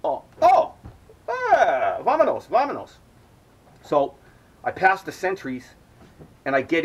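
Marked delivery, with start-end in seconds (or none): 0.0-2.9 high, loud delighted "oh" then urging; sudden recognition. Retold or imitated. Armed sentries realizing he's a buyer, high eager welcome. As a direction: switch from hostile to welcoming. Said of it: "they immediately like".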